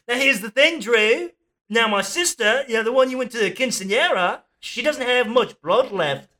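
high, put-on Aussie greeting; hammed-up Australian mate on the phone; Australian accent. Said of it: "Oh, wait, we're Australian".

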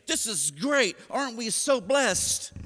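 hammed-up blissful enthusiasm; mock cheerful. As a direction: gush as if disaster were wonderful. Mock-cheerful Jesus amid disaster.

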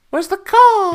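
high, loud posh British; posh British accent. Retold or imitated. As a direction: camp it up as a posh Brit. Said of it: "a posh British person saying car".

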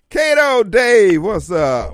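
loud, slow radio-host hail of the caller; drawn-out greeting. Real, not acted. Host loudly greets caller Kato Dave on the air. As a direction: stretch out the name to welcome him.

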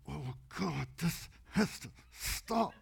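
strained, very soft mock plea; hushed plea. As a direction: act out a helpless prayer under the breath. Retelling helpless shaking in church.

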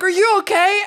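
playing worried strangers calling out; concerned strangers. People rushing to help her.

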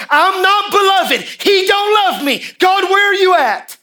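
desperate shouted lament, high and slow; anguished cry to God. Retold or imitated. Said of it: "looking in the mirror going".

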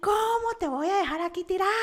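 high, loud exasperated yell; gritos imitados. Retold or imitated. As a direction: mimic him hollering in outrage. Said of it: "así unos gritos". (gritos exaltados imitados, agudos y fuertes)

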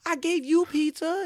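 put-on high, whining little kid; mock the pouty little brother; imitating a whining kid; whiny kid voice. Retold video, kid whining.

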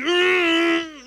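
high, loud strained effort; straining grunt. Retold or imitated. Husband wrestling wife for the ham, straining loudly.

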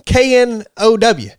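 K N O W spelled letter by letter. Shown as a demonstration. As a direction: spell out K N O W slowly. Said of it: "the word know means something".

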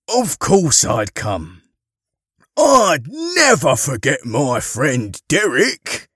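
loud, slow Father Christmas voice; hearty reassurance. Acted. Santa reassures neglected Derek, hearty and slow. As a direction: promise it big and slow.

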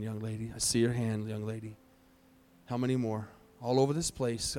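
hushed altar-call voice. Acted. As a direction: gently invite hands up. Altar call, quiet appeal.

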